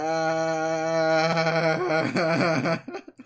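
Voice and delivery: loud, high unhinged cackle; a wild laugh that tips into madness; manic laughter. Acted. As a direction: break into a crazed laugh. Wild outburst after hatred.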